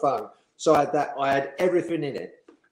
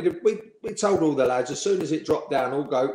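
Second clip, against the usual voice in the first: ordinary talk, nothing marked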